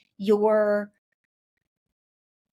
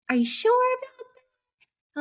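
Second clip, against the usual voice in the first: mom doubting, much higher voice; mother asking her daughter if she's sure, in a much higher mock voice; imitating mom; 3 dB louder, faster than usual. Mother questioning her daughter's choice, in a much higher imitated voice. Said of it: "She was like".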